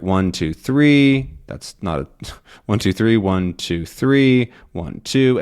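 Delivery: each number beat out separately; counting syllables. Shown as a demonstration. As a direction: tap out "one, two, three" like counting beats. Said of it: "different syllables there".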